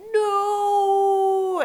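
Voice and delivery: high, loud cry of alarm; panicked no. Retold or imitated. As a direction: cry out as he flies past. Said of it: "and I'm just like".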